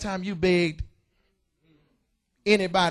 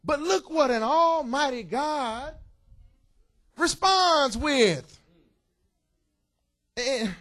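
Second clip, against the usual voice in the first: usual speaking voice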